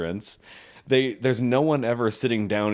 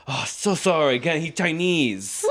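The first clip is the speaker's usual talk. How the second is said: apologetic, higher mock Japanese accent; 5 semitones higher than usual. Sushi worker apologizing at pickup, mock Japanese accent. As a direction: imitate a Japanese accent, stretching the excuse. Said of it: "I'll do the accent".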